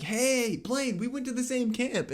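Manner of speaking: high, eager put-on greeting; mocking a friend. Roommate mocks his friend greeting an old camp acquaintance, high and eager. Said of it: "Pete's like".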